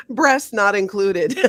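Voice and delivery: usual speaking voice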